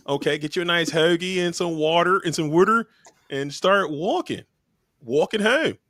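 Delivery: put-on lightly done Philly accent; a hint of Philadelphia accent, sing-song. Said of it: "That's my Philly accent".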